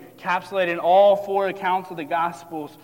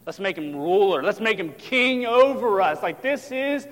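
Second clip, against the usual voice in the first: loud, raised rallying cry; excited crowd; 4 semitones higher, 7 dB louder than usual. Acted. Crowd wants to make Jesus king, loud excited rallying. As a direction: build up to acclaim a new leader. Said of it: "You know what they're thinking?".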